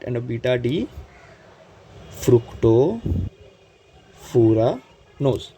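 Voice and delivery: fructofuranose stretched very slowly, broken into chunks; slow say-along. Shown as a demonstration. Teacher leading students through the sugar's name, slow syllable-by-syllable delivery. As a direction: draw out each part with long pauses.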